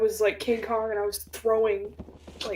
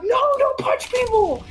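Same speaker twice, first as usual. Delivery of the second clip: much higher, shrill-ish scolding mom; exasperated mom; 4 dB louder than usual. Retold or imitated. Mom correcting dad, exasperated and high. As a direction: scold like a fed-up mother. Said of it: "And she was like".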